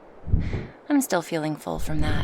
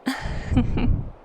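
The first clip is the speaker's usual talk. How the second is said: short chuckle, pitched up; amused chuckle; 7 semitones higher than usual. Acted. Dragoness answering her passenger about future flights, chuckling. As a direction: give a little high chuckle.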